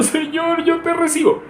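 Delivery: hammed-up mock weeping prayer, very high; mock crying. Preacher mocks believers tearfully praying, hammed-up weeping.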